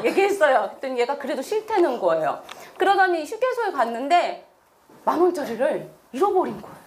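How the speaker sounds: usual speaking voice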